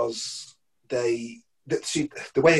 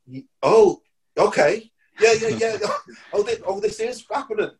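higher, louder flustered gasps of disbelief; stunned excitement; 8 semitones higher, 4 dB louder than usual. Retold or imitated. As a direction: caught off guard, thrilled. Realising the offer is real.

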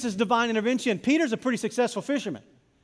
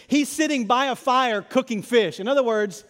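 ordinary talk

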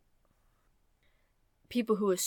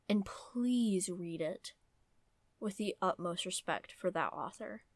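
earnest whisper; 3 dB quieter than usual. Real, not acted.

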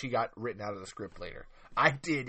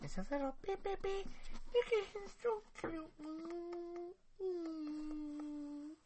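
tiny monster chirping, ending in a shriek; baby graboid voice; slower than usual. Acted. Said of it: "this is the baby graboid".

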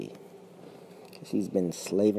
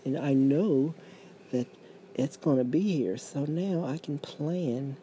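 smug pensioner; 8 semitones higher than usual. Acted. Voices a secure pensioner.

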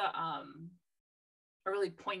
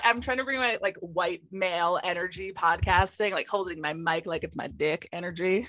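loud; 8 dB louder than usual. Pretend. Mock bro podcaster bravado.

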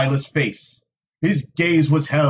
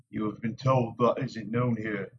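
plain reading voice

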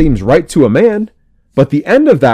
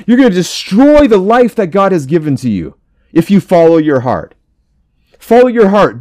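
ordinary talk

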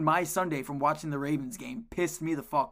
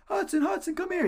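much higher call across the field; 5 dB louder than usual. Retold or imitated. Coach calling his player to the sideline, high raised call. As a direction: call the kid over from a distance.

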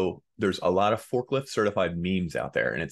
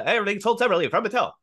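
rapid, higher disclaimer patter; fast-talking ad disclaimer; 9 semitones higher, 3 dB louder, much faster than usual. Retold or imitated. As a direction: rattle it off like a toy ad. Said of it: "the guy talks real fast".

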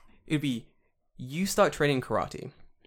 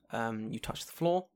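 ordinary talk, nothing marked

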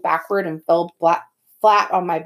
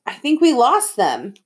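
moderate yell, pitched up; raised voice, not a full shout; 5 semitones higher, 4 dB louder than usual. A narrator's reading. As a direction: call it out to the group. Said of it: "someone yelled".

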